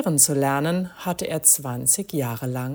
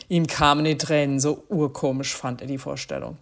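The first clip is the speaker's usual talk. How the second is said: plain reading voice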